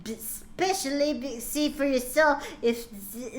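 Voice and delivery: normal talking voice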